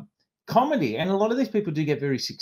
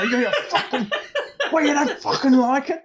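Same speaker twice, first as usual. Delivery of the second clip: higher, laughing mock threat; mock threatening comic; 10 semitones higher than usual. Housemate pulling a knife on Johnny Vegas, laughing mock threat. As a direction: snarl it through a laugh like the knife-wielding housemate. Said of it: "pull a knife on him and he'd go".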